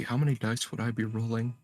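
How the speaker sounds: normal talking voice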